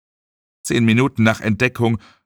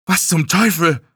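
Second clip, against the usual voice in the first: higher-pitched, alarmed outburst; startled exclamation; 7 semitones higher than usual. Acted. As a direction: exclaim in sudden bewilderment. Erschrockener Ausruf in der Geschichte. (erschrockener Ausruf)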